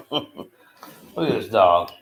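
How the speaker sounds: ordinary talk, nothing marked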